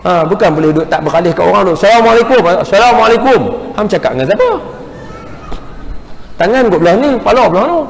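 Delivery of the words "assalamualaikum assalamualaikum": bright, higher, louder salam with eye contact; friendly greeting. Shown as a demonstration. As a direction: greet warmly while holding their gaze. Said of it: "Kena tengok mata".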